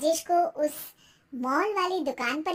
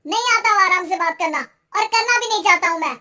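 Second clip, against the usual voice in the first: loud, raised-pitch defiant outburst; angry shouting; 4 semitones higher, 11 dB louder than usual. Acted. Husband refuses to talk calmly with wife, shouting. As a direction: shout back, refusing to calm down. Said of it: "चिल्ला क्यों रहे हो".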